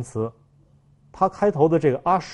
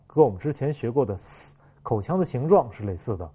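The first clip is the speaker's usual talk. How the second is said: ordinary delivery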